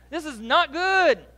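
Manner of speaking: a hint of Luther's alarm, slowed down; mock alarmed Luther. Luther voiced in retold story.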